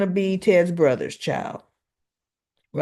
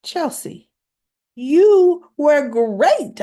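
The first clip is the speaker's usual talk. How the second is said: high, drawn-out gushing compliment; impressed praise; 8 semitones higher, 6 dB louder, much slower than usual. Retold or imitated. Mother praising her daughter after the shoot, impressed gushing. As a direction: start high, then swell loud and stretch it out. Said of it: "She was actually impressed".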